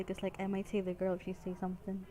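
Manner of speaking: usual speaking voice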